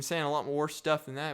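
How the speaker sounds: ordinary talk, nothing marked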